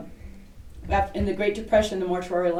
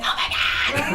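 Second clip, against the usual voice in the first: retold gasp; 5 semitones higher, faster than usual. Retold shock at ghost.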